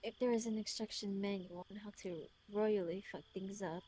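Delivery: put-on measured British-accented letter reading; his British voice. Reading his letter aloud in character, British voice. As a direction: read it as a self-reproaching British man. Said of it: "Let me read it in his voice".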